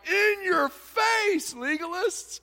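high, slow taunting yell; gloating shout. Retold or imitated. The meat-eating half taunting the legalists, loud gloating. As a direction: rub it in their faces. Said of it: "this half is like".

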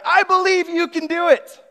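high shouted reply from far; yelling back. Retold or imitated. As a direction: yell it back across the falls. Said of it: "the prince yells back".

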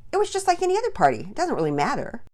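edgy, short-tempered retort; sharp. A narrator's reading. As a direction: fire it back fast, loud and high. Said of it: "answered sharply".

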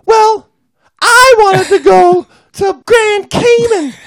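high falsetto whining complaint; whiny wife voice. Acted. Wife complaining to her husband about the cold, high falsetto whine.

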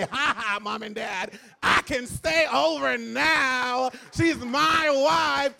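loud, slow, laughing taunt; gloating. Pretend. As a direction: crow it in triumph, drawn out. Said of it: "marry her and say".

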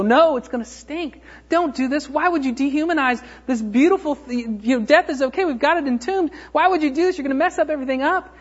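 high-pitched, frantic objection; acted flustered outcry; alarmed protest. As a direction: plead urgently to stop this. Acted protest against opening the tomb.

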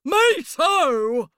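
loud, very high squeak; chiming in. A narrator's reading. Banana joins the pear's complaint, chiming in. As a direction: pipe up eagerly in a cartoon voice. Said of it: "chimed in the banana".

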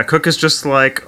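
ordinary talk

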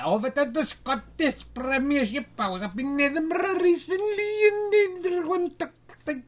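high, put-on Scottish voice for the Scottish scores; hammed-up Scottish accent. Host reading Scottish scores to viewers, mock Scottish accent. Heading to the Scottish Premiership results.

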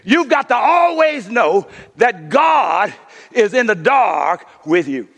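usual speaking voice

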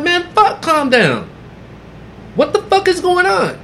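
very high, frantic protest; panicked protest. Retold or imitated. Arguing with the police.

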